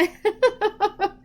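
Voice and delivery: short high laugh; bright burst of laughter. Real, not acted. Tired laugh at herself.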